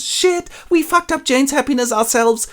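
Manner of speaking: very high shocked aside; dismayed realization. Acted. Lizzie realizing her family's fault, very high. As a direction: gasp at your own blame. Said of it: "realization aside".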